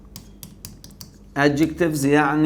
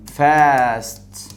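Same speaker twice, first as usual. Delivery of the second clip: each word drawn out slowly in one breath; slow one-syllable demo; 4 dB louder, much slower than usual. Shown as a demonstration. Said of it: "اي تنطق على نفس واحد". (نطق بطيء لكل كلمة في نفس واحد)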